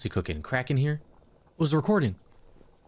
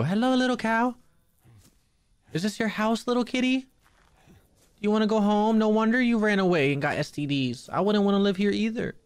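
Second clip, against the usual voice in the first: sing-song pet voice, higher; high baby talk; 8 semitones higher than usual. Pretend. Player cooing to a cat, high sing-song. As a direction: coo at the kitty.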